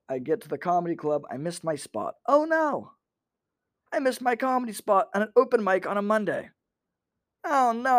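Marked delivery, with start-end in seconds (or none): 2.3-8.0 high-pitched fake "oh no"; mock dismay. Comic mocking a missed open-mic spot, mock dismay. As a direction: lament it in a sarcastic high voice.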